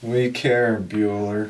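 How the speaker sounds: level monotone drone, lightly put on; Ben Stein. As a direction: drop into a droning impression. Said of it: "do it in Ben Stein's voice".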